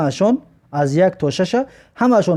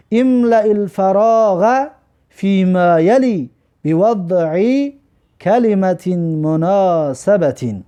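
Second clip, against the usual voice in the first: very slow, stretched-out Arabic reading; slow model reading; much slower than usual. Shown as a demonstration. Teacher reading exercise two to students, slow model reading. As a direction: draw out every word of the instruction.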